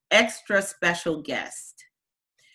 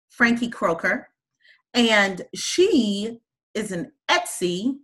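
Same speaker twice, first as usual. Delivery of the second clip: ordinary talk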